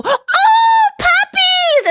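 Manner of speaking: very high, drawn-out puppy squeal; excited mock squeal. Stranger seeing a girl with three dogs, mock-excited squeal. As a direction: squeal like an overexcited dog lover. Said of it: "make them excited".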